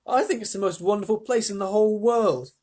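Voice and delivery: high, enthusiastic declaration; Charlie. A narrator's reading. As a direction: gush with a child's wonder. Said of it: "cried Charlie".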